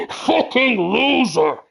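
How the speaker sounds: high, loud cackle into a drawn-out jeer; Throg. Acted. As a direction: laugh mockingly, then jeer slowly and loudly. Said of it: "you hear Throg behind him".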